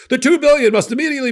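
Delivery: high, loud mock-urgent headline; exaggerated urgency; mock alarmed reporting. Mimics breathless news reporting.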